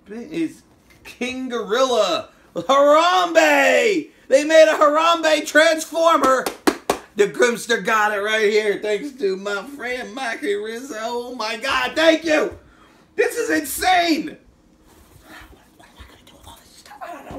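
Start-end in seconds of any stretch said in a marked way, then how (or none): 2.5-6.4 loud, high, thrilled shout of the name; excited shout. Real, not acted. Unboxing a Harambe toy, shouting in delight. 15.8-17.4 rushed breathless whisper to himself; fast whisper. Real, not acted. Overwhelmed by gifts, whispering fast to himself. Overwhelmed aside while unboxing.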